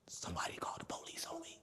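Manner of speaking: whispered, half-joking fear. Real, not acted. Whispering to his host that someone called the police. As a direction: whisper it like a secret. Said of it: "I'm getting scared now".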